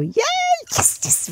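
hushed mock cheer, barely voiced; celebrating. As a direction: act out a little private celebration under the breath. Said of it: "tipo, a festejar".

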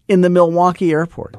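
high and loud in disbelief; can you believe it, still climbing; amazed. Real, not acted. Marvelling to the cohost about the shoe shine man, high and amazed.

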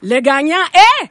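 hyped giveaway announcement. Pretend. Mock awards host reveals winner.

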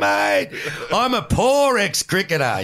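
high, loud mock-pleading mate; mock self-pity. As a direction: ham up being a poor ex-cricketer. His mock-pleading answer.

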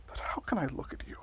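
anguished whisper. Acted. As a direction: breathe it, barely voiced. Guilty confession to a portrait.

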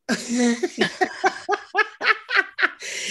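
high, falling burst of laughter; long high laugh. Real, not acted. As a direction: laugh at your own jab. Laughs at own punchline.

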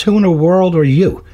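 high, baffled challenge; acted disbelief, pitched well up; incredulous demand. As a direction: play a bewildered burglar in a joke. Burglar challenges parrot in joke.

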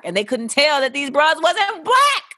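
usual speaking voice

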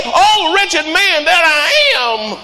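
high, slow, near-tearful lament; anguished cry. Acted. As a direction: cry it out in sorrow. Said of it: "why don't you say".

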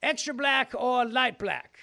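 bellowing Southern rooster-like politician; Southern accent. Pretend. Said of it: "It's my Southern accent".

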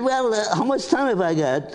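high, practical mother voice; mother's voice. Retold or imitated. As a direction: mom sizing up the job.

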